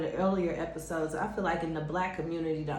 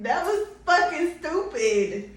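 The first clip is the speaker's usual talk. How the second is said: slow, higher, laughing; 5 semitones higher, slower than usual. Real, not acted. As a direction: drag it out amused. Laughing at herself.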